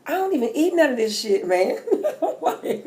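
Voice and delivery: laughing at her own cravings. Real, not acted.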